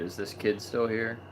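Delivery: usual speaking voice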